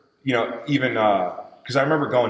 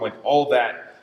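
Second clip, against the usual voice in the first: usual speaking voice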